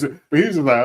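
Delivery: normal talking voice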